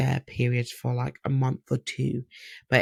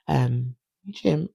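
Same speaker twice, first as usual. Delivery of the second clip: ordinary delivery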